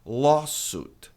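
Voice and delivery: LAWSUIT as "LAW-soot"; correct pronunciation of lawsuit. Shown as a demonstration. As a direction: stress long LAW, then "soot". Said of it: "U I, som de U".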